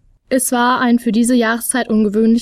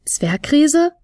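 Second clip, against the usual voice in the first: ungläubig flötend, höher gezogen, fragend; „Zwergriese?“ hoch und zweifelnd hinausflöten; 5 semitones higher than usual. A narrator's reading. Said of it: "flötete Fionella ungläubig". (Fionella kann kaum glauben, dass er Zwergriese heißt, flötet ungläubig)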